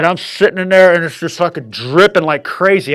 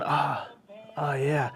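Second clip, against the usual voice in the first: much softer dreamy moan; 3 semitones lower, 16 dB quieter, slower than usual. Retold or imitated. Man left sitting alone, soft dreamy moan. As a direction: murmur it, still lost in bliss.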